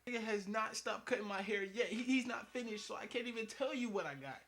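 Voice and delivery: loud, high, fed-up complaint; exasperated rant. Real, not acted. Comic telling the crowd about the endless haircut, loud. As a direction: shout it out in disbelief. Ranting that he's still cutting.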